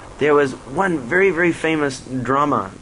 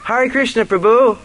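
much higher, louder cheerful hail; delighted greeting; 10 semitones higher, 5 dB louder than usual. Retold or imitated. Director greets the monk, beaming and loud. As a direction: greet him like an old friend.

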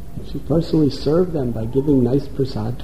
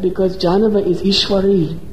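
from raised declaration to high shrieked verdict; goddess rising to wrath; 6 semitones higher than usual. Acted. Goddess Chandi explaining her rage to the dacoit, building fury.

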